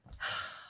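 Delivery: heavy sigh, overcome; emotional sigh. Real, not acted. Founder overwhelmed by all her plans, emotional sigh. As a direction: let out a breath as feeling wells up. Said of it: "I'm feeling emotional".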